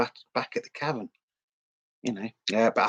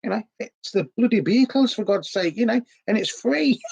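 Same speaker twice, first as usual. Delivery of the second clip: much higher, indignant outburst; exasperated; 10 semitones higher than usual. Real, not acted. Vlogger ranting at posh complainers, high and indignant. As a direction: throw your hands up at the complainers.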